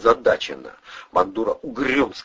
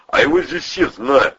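high, loud snuffling reply; snuffling captive; 5 dB louder than usual. A narrator's reading. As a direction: snuffle out an answer under threat. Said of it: "сопел спереди Любчик".

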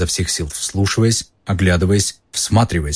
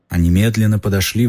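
ordinary delivery, nothing marked

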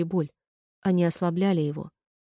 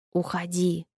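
wet gurgling monster voice; gurgling creature; slower than usual. A narrator's reading. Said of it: "пробулькал Дрековак".

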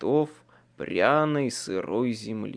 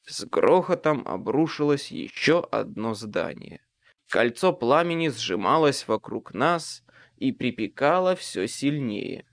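ordinary delivery, nothing marked

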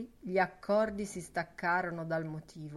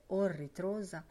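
ordinary delivery, nothing marked

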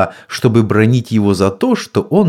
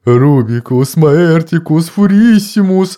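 mad monk cackle; 6 semitones higher, 4 dB louder than usual. A narrator's reading. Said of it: "хихикал сумасшедший монах".

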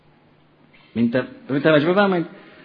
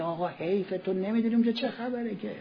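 much softer, yearning; wistful longing; 4 semitones higher, 12 dB quieter than usual. Retold or imitated. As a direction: ache for a missed pilgrimage. Longing for Karbala.

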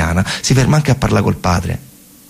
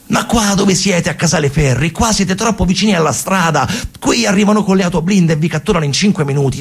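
a lightly voiced older soldier, higher, scolding the boys; mild mock army scolding, pitched up high; 8 semitones higher than usual. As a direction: tell the kids off like a worried military man. Soldier scolds the young partisans.

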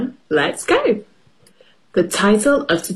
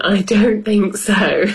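plain reading voice

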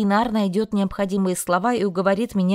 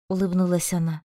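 ordinary delivery, nothing marked

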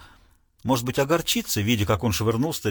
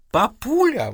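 much higher squealing cry; shriek; 9 semitones higher, slower than usual. Acted. Daughter to her father, a high shriek. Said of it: "взвизгнула Пэм".

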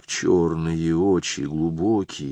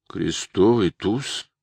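anxious bewilderment; much slower than usual. Acted. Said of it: "повторил Антоша с тревожным недоумением".

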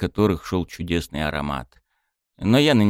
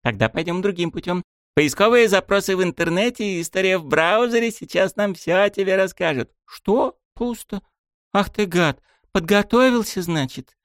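put-on high, accusing girl's voice; a girl snooping and scolding; bratty girl; 12 semitones higher than usual. Miyami's voice in narration.

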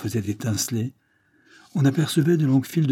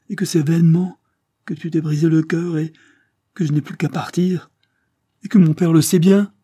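climbing higher and louder to a near-cry; rising anguished outburst; 6 semitones higher, 5 dB louder than usual. Acted. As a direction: let despair build until it breaks at the end. Henri's despairing outburst to Gilbert. (désespoir qui monte jusqu'au cri)

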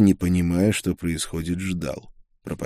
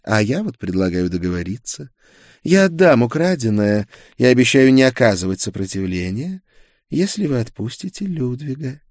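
higher-pitched coaxing offer; ingratiating; 6 semitones higher, 5 dB louder than usual. A narrator's reading. Girl bargains for Ludwig's release, ingratiating. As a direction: coax him towards a bargain. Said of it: "вкрадчиво произнесла девушка".

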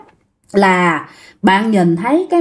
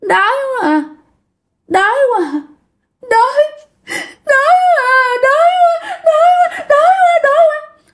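much higher, rising wail of hunger, crying. Retold or imitated. Possessed coworker wailing at her colleague, crying and rising. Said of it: "la làng, rồi khóc lên".

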